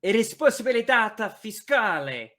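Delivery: put-on slow, loud, showy Italian accent; confident mock Italian. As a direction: declaim it with hammed-up Italian flourish. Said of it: "Say a thing in Italian".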